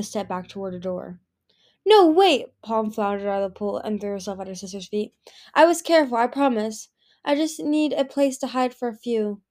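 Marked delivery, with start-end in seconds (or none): 1.9-2.5 much higher, louder panicked cry; desperate cry. Acted. Palm crying out as her sister turns away. 5.5-9.4 pleading. Acted. Palm pleading at sister's feet.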